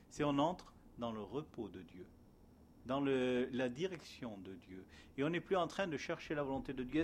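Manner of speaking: usual speaking voice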